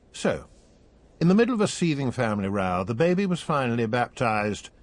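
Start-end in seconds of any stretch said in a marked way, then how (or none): none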